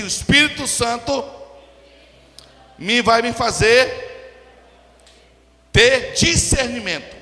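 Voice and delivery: loud, slow phrases for the congregation to repeat; call-and-response declamation. Shown as a demonstration. Preacher has congregation repeat after him, loud slow declamation. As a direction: proclaim it slowly and forcefully. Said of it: "Fala comigo assim".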